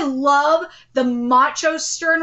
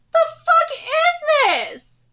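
outraged cry; 12 semitones higher, slower than usual. Real, not acted. Angry reaction to bad animation.

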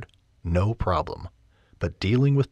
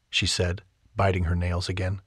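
ordinary talk

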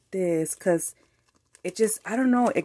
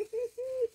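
giddy laugh; 8 dB quieter than usual. Real, not acted. Delighted laugh after squeal.

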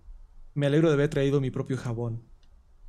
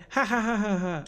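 a hint of a hammed-up villain's ha-ha; mock villain laugh; 6 semitones higher than usual. Villain gloating over the boy, mock evil laugh. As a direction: laugh like a comic-book villain, lightly.

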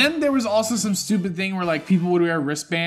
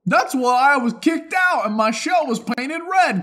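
raised character voice; 7 semitones higher than usual. Pretend. Continues character bit.

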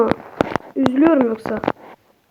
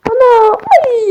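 high sudden yelp; startled cry; 9 semitones higher, 4 dB louder, slower than usual. Real, not acted. Startled by the baby in the game, a high yelp. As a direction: jump at the surprise.

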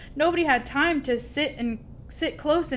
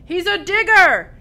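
loud, high, drawn-out holler; big pirate captain shout; yelling to crew; 9 semitones higher, 11 dB louder than usual. A narrator's reading. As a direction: yell it back to your shipmates. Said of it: "he yelled back at his crew".

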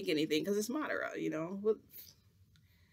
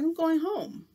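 fed up; 12 semitones higher, 7 dB louder, slower than usual. Retold or imitated. Storming off after the laxative trick.

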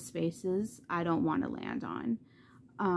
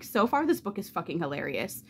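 normal talking voice

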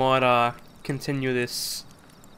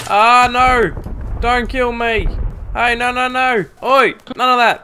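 high, loud yelps of protest; loud, high-pitched protest; alarmed shouting; 9 semitones higher, 7 dB louder, slower than usual. Real, not acted. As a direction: shout them off as you are attacked. Under attack, pleading not to die.

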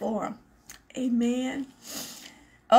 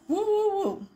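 woo; 11 semitones higher than usual. Real, not acted. Shaking off tears, cheering herself.

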